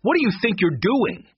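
high, indignant challenge; angry. A narrator's reading. Eddie confronts the children holding him, angry. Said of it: "Eddie said angrily".

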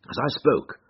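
ordinary delivery, nothing marked

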